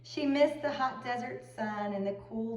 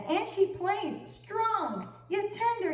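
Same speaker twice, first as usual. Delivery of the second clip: sighing agent; 11 semitones higher than usual. A narrator's reading. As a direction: swoon over the playing. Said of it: "he sighed".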